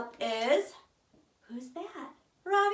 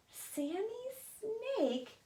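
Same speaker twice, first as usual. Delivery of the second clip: ordinary delivery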